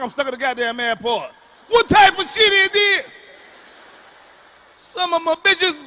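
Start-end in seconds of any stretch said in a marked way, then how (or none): 1.7-5.8 slow, much higher and louder furious shout; angry yell. Real, not acted. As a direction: bellow it at the whole airport. Ranting about stuck at airport.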